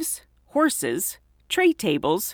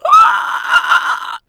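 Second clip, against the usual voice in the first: sudden shriek of horror; shocked scream; 9 dB louder than usual. Retold or imitated. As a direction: scream at the mirror. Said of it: "it was like".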